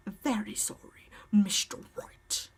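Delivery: slow, drawn-out whisper; whispered apology. Acted. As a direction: whisper it slowly to the rival lawyer. Opponent's mock apology in court.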